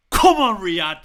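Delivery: loud, high come on; roared cheer. Real, not acted. Fan urging his team after a goal, roared cheer. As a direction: bellow it at the screen.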